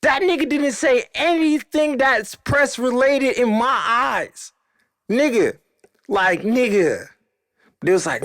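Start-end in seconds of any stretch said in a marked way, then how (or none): 0.0-7.1 high-pitched, worked-up disbelief; buck wild. Retold or imitated. Storyteller protests to the players he wasn't pressed, wild indignation. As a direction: replay an indignant rant, voice way up high. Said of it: "I'm getting a little buck wild".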